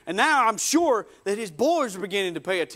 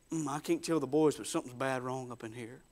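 low, soft worried thought to himself; worried aside; 8 semitones lower, 9 dB quieter than usual. Acted. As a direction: keep it from the boys, murmured low. Simon's worried thought, hidden from boys.

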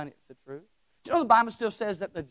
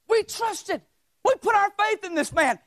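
higher, pleading voice of a let-down follower; grieving disciple; 10 semitones higher than usual. Retold or imitated. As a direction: cry it out like a man whose hope broke. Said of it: "Cleophas is saying".

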